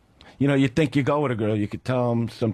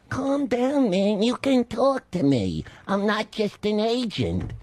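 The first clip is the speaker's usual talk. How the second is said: high, slow, earnest agent voice; agent Dennis; 7 semitones higher, slower than usual. Retold or imitated. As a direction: imitate a concerned agent soothing a client, pitched well up. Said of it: "He's going".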